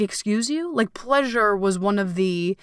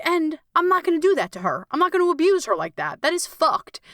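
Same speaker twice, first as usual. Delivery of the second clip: raised, fast, indignant vow; indignant refusal; 8 semitones higher than usual. Retold or imitated. To herself, refusing to abuse her inner child, indignant and high. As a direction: refuse it hotly in a higher voice. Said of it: "And I was like".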